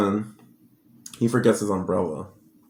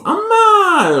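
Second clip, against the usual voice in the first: high excited shout of Amma; child calling out; 5 dB louder than usual. Retold or imitated. A little son running to his mother, shouting high and happy. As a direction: call out to mom with joy.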